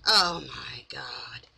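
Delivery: slow whispered disbelief. Real, not acted. Vlogger reacting to Britt's act in a slow whisper. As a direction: breathe it out under the breath. Aside after the innocent act.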